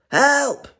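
high, loud cry for help. Acted. Animals frightened for Zebra, crying out. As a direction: call out in acted panic. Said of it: "cried the animals".

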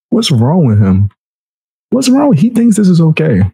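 ordinary talk, nothing marked

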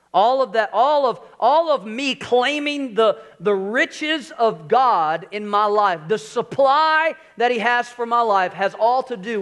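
loud, slow, stretched-out preaching with a lifted pitch; full-force preaching. Acted. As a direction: preach it out slowly to the church. Preaching builds on God's supply.

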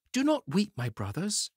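put-on high, bright-hearted little boy comforting; encouraging Tom Thumb. A narrator's reading. Tom Thumb comforting his crying brothers, high and encouraging. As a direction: lift them up, small brave voice. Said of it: "he said encouragingly".

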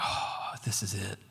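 barely voiced, overcome with wonder; awed whisper. Back in the Holy of Holies, hushed awe.

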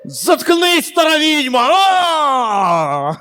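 high screaming at a witch; hammed-up horror-film shriek; exorcism scream. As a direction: shriek like a possessed nurse. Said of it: "і ви такі".

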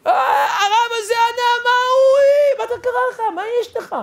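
very high, overwrought cry; imitating a groom screaming in amazement; imitated roar. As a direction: let out a hammed-up high roar, then ease off. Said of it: "איזה שאגה הוא צעק".

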